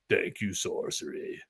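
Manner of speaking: slow, soft breathy whisper of thanks; dark whisper. Retold or imitated. Artist's dark clip voiced as a slow whisper. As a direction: hush it like a dark force thanking you. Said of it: "like, this dark force".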